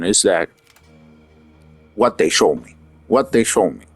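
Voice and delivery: ordinary talk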